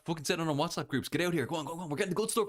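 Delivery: rally everyone to spread it; excited hype. Real, not acted. Hyping viewers to share the stream.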